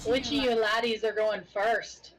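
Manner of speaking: heavy Irish brogue, slow and loud; Irish accent. Acted. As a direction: lay on a thick Irish accent. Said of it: "let's get right into the Irish accent".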